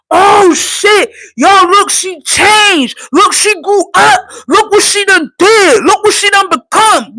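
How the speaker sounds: high-pitched pretend hype from onlookers; loud, excited imitation, each phrase punched; mock amazed hollering. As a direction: shout it like an astonished crowd. Voices praise she expects but misses.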